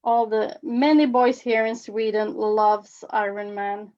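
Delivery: normal talking voice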